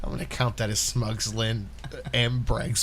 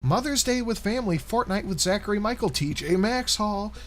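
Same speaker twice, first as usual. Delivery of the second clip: announcing titles; 10 semitones higher than usual. Acted. Reading video titles aloud.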